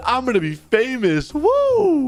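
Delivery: high, excited cry rising to a loud whoop; gleeful whoop. Acted. Bystander filming for fame, gleeful. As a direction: crow it, then whoop.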